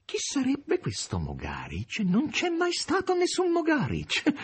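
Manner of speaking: slow, drawn-out mock puzzlement rising very high; Korofiev mimicking denial. A narrator's reading. Korofiev denies anyone ever existed, slow and high-pitched. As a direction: stretch it out, leaping high on the denial.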